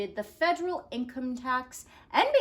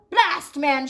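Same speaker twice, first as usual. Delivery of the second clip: loud offended blast; shouted; 4 semitones higher, 8 dB louder than usual. Acted. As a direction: snap it out, offended. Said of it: "shouted Taft".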